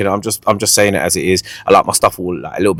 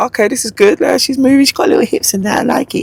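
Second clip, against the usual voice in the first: impressed inner voice; 14 semitones higher than usual. Retold or imitated. Inner reaction to the dancing.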